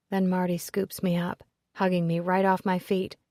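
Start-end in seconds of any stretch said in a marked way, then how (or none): none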